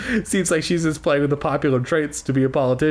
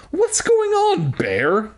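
very high-pitched acted question; crying out; 14 semitones higher, much slower than usual. A narrator's reading. Girl confronts her teddy, high exclaiming. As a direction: squeal the question, dropping on the last word. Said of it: "Willa cried out".

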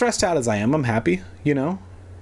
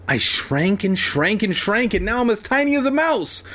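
high panicked voice; 8 semitones higher than usual. Acted. Evan panics, shrunk.

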